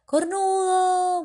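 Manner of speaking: high, slow drawn-out taunting yell; mocking shout. Biker taunting the jogging man, long mocking shout. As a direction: stretch the insult out high and loud. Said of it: "le dice".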